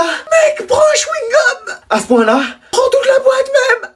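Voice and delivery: very high, crying-out shout of disgust. Acted. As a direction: scream it as if the breath knocked you back. Reacting to friend's bad breath. (hurlement aigu de dégoût)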